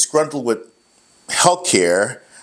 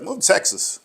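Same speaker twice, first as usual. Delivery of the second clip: put-on country accent; 10 semitones higher than usual. Buddy urging Rick to move to Texas, imitated country accent. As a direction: drop into the buddy's Texan country twang. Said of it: "he said in his country accent".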